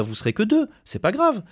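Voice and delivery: high, breezy mock of management brushing it off; mock dismissive boss. Union rep mimicking management telling workers it's fine, breezy high mockery. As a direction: pitch it up like a careless manager saying it's no big deal. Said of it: "Et on leur dit, bah".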